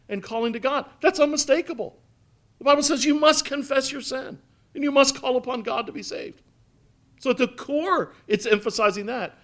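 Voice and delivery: lifted, ringing pulpit delivery; preaching at full force. Acted. Pastor pressing the congregation on confession, preaching at full force. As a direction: drive the point home from the pulpit.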